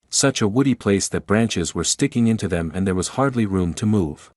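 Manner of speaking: normal talking voice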